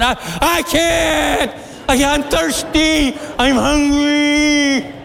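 put-on very high, slow child's whine; whiny little girl. Little girl told to wait for hours, whining. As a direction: stretch each complaint out high like a small child. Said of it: "and she said".

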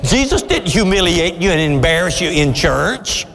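normal talking voice

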